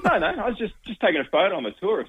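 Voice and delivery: high, nervous excuse; flustered excuse. Retold or imitated. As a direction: fumble the excuse, caught out.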